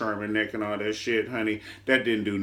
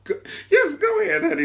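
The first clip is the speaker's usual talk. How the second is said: much higher, louder, a crying put-on voice; mock wail; 6 dB louder, faster than usual. Host mocking the mistress being sent inside, mock crying. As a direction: ham up a tearful plea.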